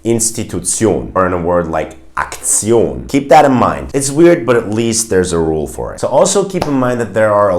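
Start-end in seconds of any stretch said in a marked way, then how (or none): 0.0-1.1 INSTITUTION as "in-sti-too-TSYOHN"; German pronunciation. Shown as a demonstration. Teacher shows how Germans say it, German pronunciation. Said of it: "it's pronounced differently". 2.1-3.1 AKTION as "ak-TSYOHN"; German -tion ending. Shown as a demonstration. Teacher gives another example, German -tion ending. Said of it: "We say".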